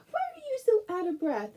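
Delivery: high, cooing pet voice; talking to the dog. Real, not acted. Talking baby talk to her dog.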